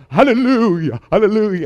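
high, loud hammed-up quavering hallelujah; mock quivering hallelujah. Pastor mocks a televangelist laying on hands, hammed-up hallelujah. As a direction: ham up a televangelist's trembling hallelujah. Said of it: "have your voice quivering".

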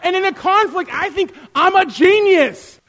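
loud, high-pitched comic outburst; hammed-up exasperated bragging; mock self-righteous rant.

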